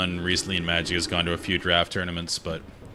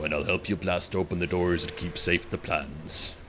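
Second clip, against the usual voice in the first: a lightly put-on low, soft voice; really low voice; 5 dB quieter than usual. Gamer reading quest text in a mildly low, soft voice. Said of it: "I'll just go really low".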